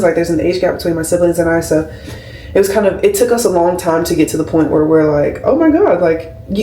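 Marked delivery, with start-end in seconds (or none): 5.4-6.0 excited gasp. Retold or imitated. Realizing sibling is cool.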